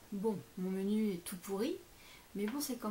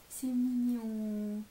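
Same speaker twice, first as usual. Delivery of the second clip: tender and slow; 4 dB louder, much slower than usual. Real, not acted. Touched by the card message.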